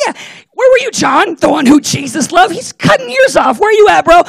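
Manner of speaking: high, loud worked-up call-out; indignant outburst. Retold or imitated. First-time reader calling out John, high indignant shout. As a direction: yell at John like a fired-up fan.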